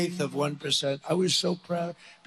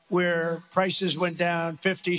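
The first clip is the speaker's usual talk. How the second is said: ordinary talk, nothing marked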